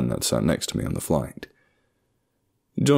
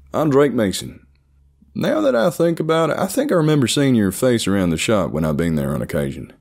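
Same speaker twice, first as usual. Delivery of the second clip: put-on high-pitched, chatty country-accented voice; 9 semitones higher, 4 dB louder than usual. A narrator's reading. Seatmate introducing himself at the handshake, country accent. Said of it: "he spoke with a thick country accent".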